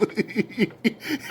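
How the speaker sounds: high breathy wheezing laugh; imitated laughing fit. Rapper losing it over a joke, wheezy laughter. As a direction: mimic him losing it. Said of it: "the way he was laughing".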